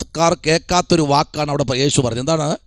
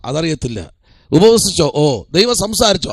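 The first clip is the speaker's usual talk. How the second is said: usual speaking voice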